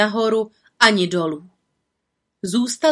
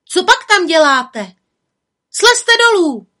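loud, much higher scolding shout; 10 semitones higher, 7 dB louder than usual. Acted. Little witch shouting at the nest robbers. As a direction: order them down.

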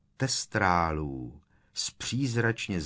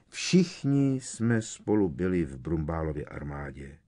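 usual speaking voice

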